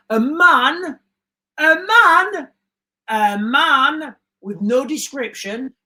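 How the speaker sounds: hammed-up suspense reading; dramatic reveal. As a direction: build it up like a breaking-news bombshell. Dramatic breaking-news reveal.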